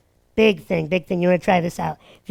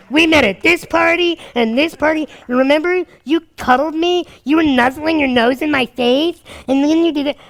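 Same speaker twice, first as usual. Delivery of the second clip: higher, louder insistent recap; insistent girl; 6 semitones higher, 3 dB louder than usual. Retold or imitated. Imitating the girl listing their meetings, higher and louder. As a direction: rattle off the list, pushing hard.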